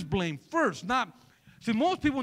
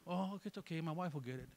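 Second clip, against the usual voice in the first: softer, offhand brush-off; mild lazy husband; 4 semitones lower, 11 dB quieter than usual. Acted. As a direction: shrug it off quietly, a hint of laziness. Acted lazy passive husband.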